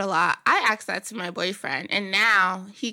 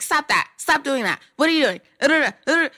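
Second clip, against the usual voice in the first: louder, higher scolding yell; mock yelling; 5 semitones higher, 6 dB louder than usual. Relative yelling at someone who pulls hair, loud scolding. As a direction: snap at them like a nagging relative. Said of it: "You don't wanna yell at them and be like".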